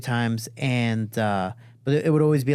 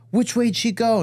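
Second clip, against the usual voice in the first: high, urgent retold question; raised-pitch pressing question; urgent dad demand; 10 semitones higher, 4 dB louder than usual. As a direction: imitate dad demanding an answer. Retold dad demands during chase.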